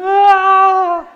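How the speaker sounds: high, loud mock wail of a frustrated toddler; imitating a little boy's despairing yell; toddler tantrum wail. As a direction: throw the cry out like a child in a tantrum. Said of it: "and he'll go".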